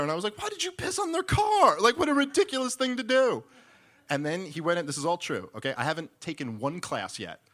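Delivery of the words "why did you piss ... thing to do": very high, loud disbelieving shout; incredulous yell. Retold or imitated. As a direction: shout back at him in disbelief, much higher and louder. Yelling back at John, incredulous.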